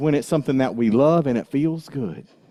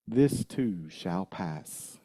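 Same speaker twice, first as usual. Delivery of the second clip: This too shall pass said slow, much softer, lower; hushed repeat; 7 semitones lower, 10 dB quieter, slower than usual. Shown as a demonstration. Minister repeating the line softly to the congregation. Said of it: "repeated softer".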